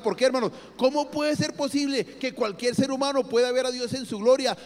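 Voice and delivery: ordinary talk, nothing marked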